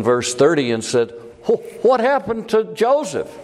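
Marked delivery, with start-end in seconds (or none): 1.5-3.3 alarmed question. Retold or imitated. Reuben finds brother gone.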